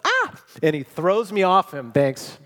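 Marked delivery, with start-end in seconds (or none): 0.0-0.4 sudden high yelp of alarm, much louder; startled yell. Retold or imitated. Brother's panicked yell at hearing the snap, a sudden high yelp. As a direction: brother yelps in shock as the leg snaps. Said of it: "My brother goes".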